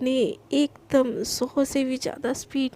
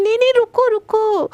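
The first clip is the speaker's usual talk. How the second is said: much higher, loud cry to stop her; desperate call; 7 semitones higher, 6 dB louder than usual. Acted. Priyanka cries out loud and high to stop Garima leaving. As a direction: call her back urgently.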